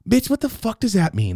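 high-pitched incredulous demand; acted outraged confusion; indignant disbelief. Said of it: "looks at Jennifer and says".

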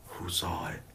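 low, very soft guilty aside; furtive hush. Retold or imitated. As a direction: drop to a secretive near-whisper. Furtive thought after the accident.

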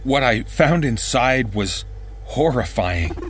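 plain reading voice

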